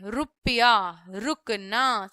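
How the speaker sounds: रुपया and रुकना drawn out very slowly, low; very slow examples. Shown as a demonstration. Teacher showing the र with उ slowly. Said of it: "आगे लगाएंगे".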